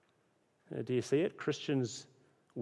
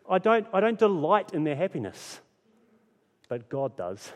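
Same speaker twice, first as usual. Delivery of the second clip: ordinary talk, nothing marked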